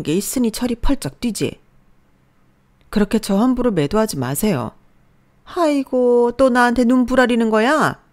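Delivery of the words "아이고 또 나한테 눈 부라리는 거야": 높고 큰 소리로 역정 내듯; 며느리를 나무라며 목청 높여. Acted. 시어머니가 며느리에게 역정냄. (시어머니가 며느리를 타박하며 높고 크게)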